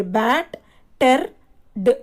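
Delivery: "Bat, ter, d" as three separate beats; "Bat, ter, d" broken apart for a class; syllable by syllable. Shown as a demonstration. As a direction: sound out "Bat, ter, d" with a pause after each piece. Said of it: "three syllables are there".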